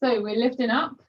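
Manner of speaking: ordinary delivery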